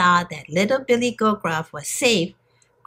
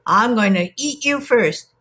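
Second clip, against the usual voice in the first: put-on troll threat, pitched up; growling troll; 4 semitones higher than usual. A narrator's reading. Troll threatens to eat little goat, growling. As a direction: menace the goat as a hungry troll. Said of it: "growled the troll".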